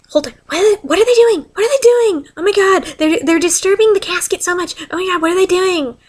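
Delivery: reliving the shock of watching the casket; alarmed disbelief. Retold or imitated. Alarmed at cemetery workers.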